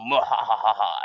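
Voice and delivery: mock cartoon villain cackle; hammed-up mwahaha laugh; evil villain laugh. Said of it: "twirling his mustache like".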